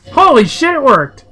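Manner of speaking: high, loud burst of delight; excited. Real, not acted. Player cheering as the gambit on Kuno works, high and loud. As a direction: cheer like you just won. Player thrilled the trick worked.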